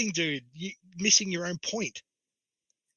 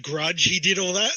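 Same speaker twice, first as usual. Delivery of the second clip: usual speaking voice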